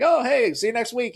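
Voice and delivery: high, loud sing-out goodbye; mock breezy farewell. Mimicking breezy church farewell.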